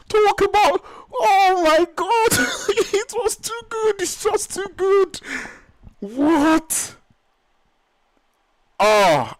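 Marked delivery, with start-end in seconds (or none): 0.1-7.0 laughing cry. Real, not acted. Caster overwhelmed by the play.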